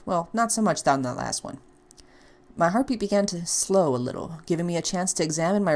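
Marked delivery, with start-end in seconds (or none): none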